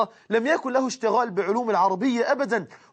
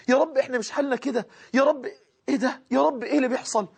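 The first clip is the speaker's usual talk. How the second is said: moved sermon; 4 semitones higher than usual. Real, not acted. Moved retelling of Arafat day.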